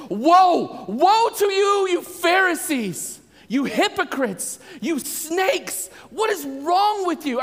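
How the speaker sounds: high, loud, slow furious woes; angry rebuke. Retold or imitated. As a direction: thunder the woes at the Pharisees. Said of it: "he is upset".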